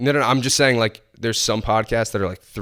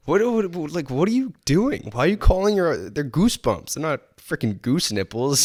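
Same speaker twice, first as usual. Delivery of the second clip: higher-pitched, incredulous protest; mock indignant; 6 semitones higher than usual. Speaker scolding people who say goose nipples, mock outrage. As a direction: object in comic disbelief at their word. Acted protest over odd word.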